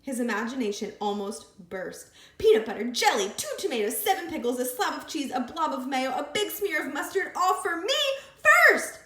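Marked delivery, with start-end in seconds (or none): 2.4-9.0 excited. A narrator's reading. Imagining the sandwich.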